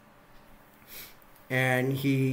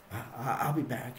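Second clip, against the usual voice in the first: flustered mumble; 2 semitones lower, 9 dB quieter, faster than usual. Retold or imitated. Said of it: "Dan Cain's like".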